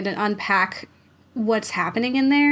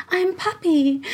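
high, airy mock whisper-talk; put-on high, creepy little voice; 8 semitones higher than usual. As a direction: imitate a creepy whispery performer, pitched way up. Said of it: "talks, like, whisper talks".

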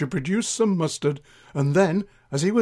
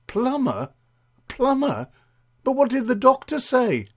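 high-pitched incredulous questioning; puzzled friend, voice pitched way up; baffled disbelief; 10 semitones higher, 3 dB louder than usual. Acted. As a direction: query it in comic bewilderment, pitch swooping down on each word.

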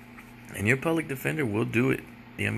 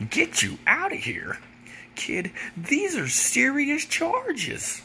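much higher, slow, hammed-up mock of the lawyer; slower than usual. Comedian mocks his public defender in a high mocking voice. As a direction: lampoon the pompous lawyer, dragging it out. Lawyer mocked in retold story.

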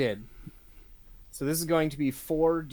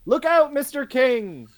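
high, loud shout of alarm; urgent in-character shout; warning yell; 11 semitones higher, 10 dB louder than usual. Acted. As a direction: yell a warning across a crowded theatre. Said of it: "And then Swifty will yell".

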